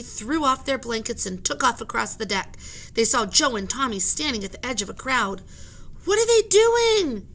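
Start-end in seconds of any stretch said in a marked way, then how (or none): 6.0-7.3 put-on high, loud, slow girl's yell. Annie yells across the deck to the sailors, high and loud. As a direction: yell it up to the crowd. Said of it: "Annie yelled".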